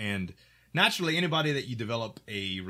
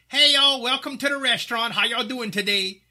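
loud, much higher Cajun waiter greeting; Cajun accent; 12 dB louder than usual. Retold or imitated. Young waiter welcoming restaurant guests, loud high Cajun accent. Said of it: "me and my Cajun accent".

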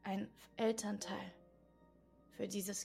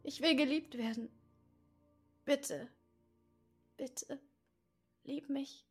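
put-on higher, louder childlike plea that fades to a small voice; inner child pleading; 5 semitones higher, 4 dB louder than usual. The inner child stamping its foot, pleading to be loved. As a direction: beg like a stamping little child. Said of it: "das auf den Boden stampft und sagt".

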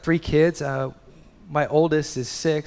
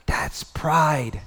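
whisper. Real, not acted. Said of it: "whispered line".

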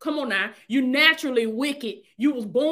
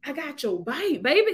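tender reassurance; 4 dB quieter than usual. Acted. Preacher voicing God comforting a frightened believer, tender. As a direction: hush it like a comforting promise. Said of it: "God said".

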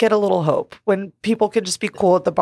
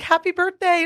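chirpy wish; 10 semitones higher than usual. Retold or imitated. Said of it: "I was like".

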